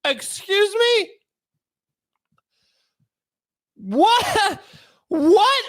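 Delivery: high-pitched, incredulous questions with long pauses; stunned disbelief. Acted. Defendant hears his public defender speak, stunned disbelief. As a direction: yelp each one, flabbergasted.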